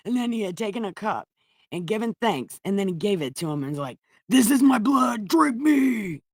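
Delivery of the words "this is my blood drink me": mock grand Jesus, rising to a shout. Jesus voiced in a retold scene.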